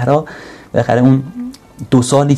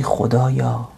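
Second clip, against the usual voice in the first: soft prayer; 5 dB quieter, much slower than usual. Pretend. Quoted whispered-like prayer.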